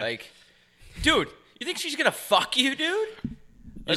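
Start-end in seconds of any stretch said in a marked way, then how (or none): 1.0-3.1 high, incredulous ribbing; mocking dude-bro. Comic taunting guys who flirt with waitresses, mocking. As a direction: rib the clueless bro, pitched up. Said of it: "same guys that are like".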